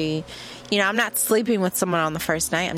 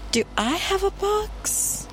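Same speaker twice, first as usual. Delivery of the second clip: high, slow, stunned question; gasping disbelief as if caught out; high and drawn out, can't believe it; shocked disbelief; 12 semitones higher, slower than usual. Real, not acted. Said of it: "literally scream".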